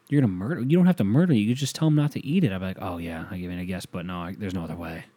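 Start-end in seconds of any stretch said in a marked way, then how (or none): 0.1-2.5 high-pitched mock disbelief, pitch jumping about; incredulous objector. Voices objectors in imagined argument. 2.8-5.0 low, soft deadpan reply; resigned insistence. Acted. Voices own stubborn reply.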